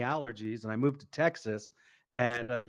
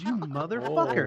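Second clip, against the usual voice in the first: outraged dad; 7 semitones higher than usual. Retold or imitated. Acts out dad's outrage.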